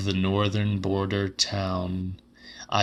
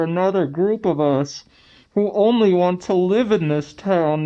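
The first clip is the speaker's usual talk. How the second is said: higher, louder, building conviction about demons; raised fevered voice; 8 semitones higher, 5 dB louder than usual. A narrator's reading. Lounger on the old divan declaring the demon town, higher and louder. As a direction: proclaim it like a man half mad with belief.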